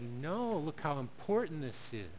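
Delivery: soft and slow, patiently setting him right; God gently correcting the husband, soft and slow; gentle divine correction. Retold or imitated. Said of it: "And God said".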